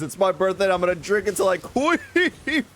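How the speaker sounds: mock party boast. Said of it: "Wolf says".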